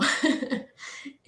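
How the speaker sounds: short laugh, mostly breath; breathy laugh. Real, not acted. Remembering her daily question to the patient, breathy laugh. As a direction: let out an airy chuckle.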